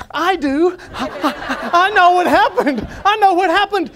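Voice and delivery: bursting with knowing glee; excited inner voice. Retold or imitated. Preacher recalls his own eager thought on hearing the recording, excited and laughing. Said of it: "And I'm thinking".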